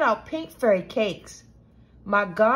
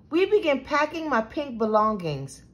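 usual speaking voice

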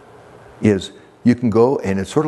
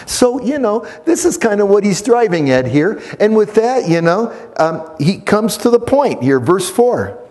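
higher and louder preaching; full-force preaching; 11 semitones higher, 4 dB louder than usual. Acted. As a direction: preach it out to the whole church.

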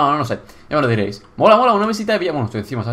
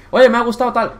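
higher, louder sample compliment; imagined viewer comment; 8 semitones higher, 5 dB louder than usual. Pretend. Player voicing a viewer's comment, lifted and enthusiastic. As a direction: call it out like an approving viewer. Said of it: "podéis dejar y decirle".

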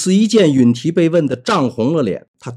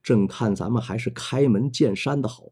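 softer, cold and level; 4 semitones lower, 8 dB quieter than usual. Acted. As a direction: let the words fall icily. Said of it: "冷冷地说道".

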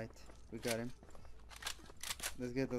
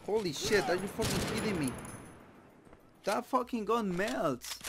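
raging gamer; 6 semitones higher than usual. Real, not acted. Gamer raging at enemy gun.

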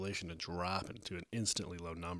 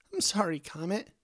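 high, louder, pleading voice; distressed, high-pitched sorry; upset apology; 10 semitones higher, 5 dB louder than usual. A narrator's reading. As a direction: apologise over someone, close to tears. Angel apologises through tears.

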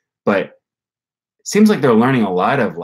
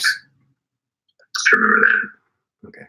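whispered to self; much slower than usual. Real, not acted. Muttering while writing a note.

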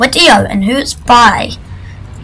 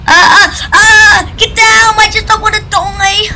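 panicked yelling; 9 semitones higher than usual. Real, not acted. Panic in a game fight.

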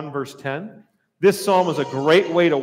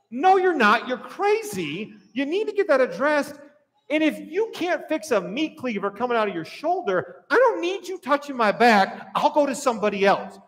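higher-pitched mock protest to a doctor; incredulous objection; 7 semitones higher than usual. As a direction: argue back in exasperated disbelief. Acted patient arguing back at doctor.

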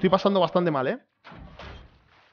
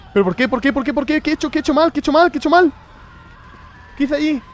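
higher, louder despairing cries; desperate panic; 9 semitones higher, 6 dB louder than usual. Real, not acted. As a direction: plead in panic after getting caught. Caught by monster, despairing.

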